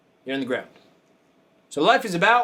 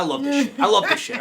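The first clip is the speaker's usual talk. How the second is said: laughing quote; 7 semitones higher than usual. Retold or imitated. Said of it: "He goes".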